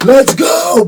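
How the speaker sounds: high, loud hype shout. Shown as a demonstration. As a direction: yell it to fire up the team. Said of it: "is go like this".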